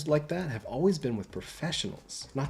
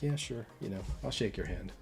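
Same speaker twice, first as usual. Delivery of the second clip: off-putting; 9 dB quieter than usual. Retold or imitated. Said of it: "kind of off-putting".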